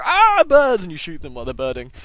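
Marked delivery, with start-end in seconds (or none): 0.0-0.8 high, loud hammed-up fright; mock panicked scream. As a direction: yelp like a cartoon victim. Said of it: "and they go".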